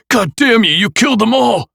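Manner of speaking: loud high raging cry; furious shout. A narrator's reading. Nick raging at the killer, screaming. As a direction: scream the curse through tears.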